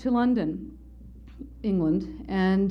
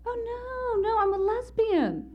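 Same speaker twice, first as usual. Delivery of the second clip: very high, drawn-out "oh no" as her; hammed-up high-voiced denial; indignant mock denial; slower than usual. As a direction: imitate her protesting, pitch shooting up. Voices woman denying being bisexual.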